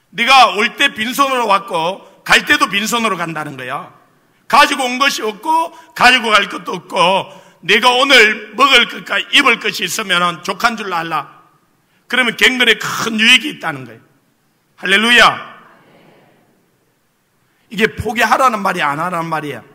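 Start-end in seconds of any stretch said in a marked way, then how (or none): 0.1-11.4 louder, preached scripture lines; scripture proclaimed. Retold or imitated. Pastor quotes the Lord's answer to the congregation, preached louder. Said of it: "주님이 말씀을 하시는 거예요". 14.8-15.6 louder proclaimed hallelujah. Real, not acted. Pastor rallies the congregation with a loud hallelujah.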